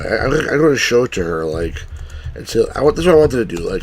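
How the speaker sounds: usual speaking voice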